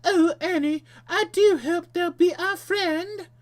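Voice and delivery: very high, loud British falsetto; British accent. Acted. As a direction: answer in a squeaky British accent. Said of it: "answers herself in a British accent".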